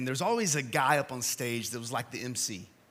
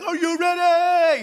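high, loud hyped-up MC call; a camp MC revving up teenagers, high and loud; hype man shout; 13 semitones higher, 9 dB louder, slower than usual. Retold or imitated. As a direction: pump up the crowd like a camp MC. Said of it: "he'd be running around like".

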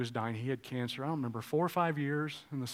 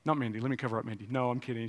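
usual speaking voice

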